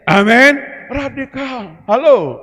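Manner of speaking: loud high call to the crowd; shouted amen. Real, not acted. Pastor calling the congregation to answer Amen, shouted loud and high.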